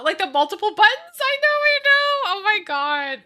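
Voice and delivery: high, loud squeal of delight; squealing excitement. Real, not acted. Gushing to chat about dad's old beeper, squealing with excitement. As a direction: gush over it like a fangirl.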